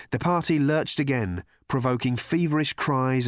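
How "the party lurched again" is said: plain reading voice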